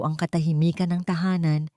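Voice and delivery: ordinary delivery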